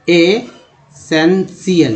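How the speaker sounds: एसेंशियल drawn out very slowly, each syllable clear; very slow demo word. Shown as a demonstration. Teacher models the word for students, very slowly. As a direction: stretch एसेंशियल right out for the class. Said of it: "टी आई एल को सी एल बोलते हैं".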